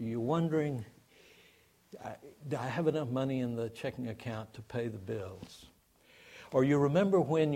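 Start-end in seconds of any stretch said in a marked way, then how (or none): 2.0-5.7 softer, anxious inner question; fretting over the checking account, softly; worried murmur. Retold or imitated. Worried inner thought about bills.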